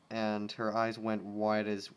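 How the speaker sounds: ordinary delivery, nothing marked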